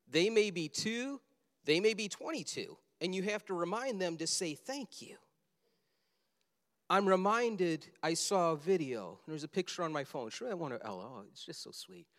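normal talking voice

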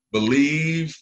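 call-and-response lead. Real, not acted.

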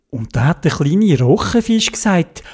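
plain reading voice

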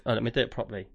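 ordinary talk, nothing marked

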